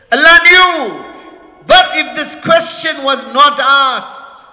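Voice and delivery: emphatic sermon declaration; impassioned preaching. Real, not acted. Preacher answering his own question, with fervour. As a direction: ring it out to the congregation.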